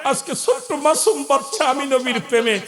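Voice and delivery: high, wailing lament building to a cry; tearful lament. Acted. Preacher voices the weeping Prophet over the slain boys, mounting tearful lament. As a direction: build from grieving call to full-voiced plea. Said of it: "আমার নবী কান্দার কয়".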